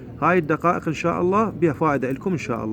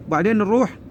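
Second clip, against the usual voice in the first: ordinary talk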